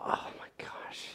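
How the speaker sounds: slow, voiceless whisper of dismay; stunned whisper. Retold or imitated. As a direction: whisper it slowly, as a thought in shock. Retold shock at seeing his son.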